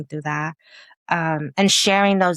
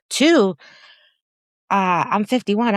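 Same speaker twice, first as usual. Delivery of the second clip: usual speaking voice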